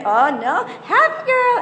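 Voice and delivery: put-on high, loud silly kids-show voice; over-the-top cheerful coo; ridiculous Barney cheer. Shown as a demonstration. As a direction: ham it up like Barney. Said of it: "you be ridiculous Barney face".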